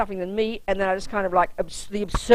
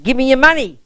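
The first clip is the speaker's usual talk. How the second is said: retold mugger demand; 5 semitones higher, 8 dB louder than usual. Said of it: "he said something like".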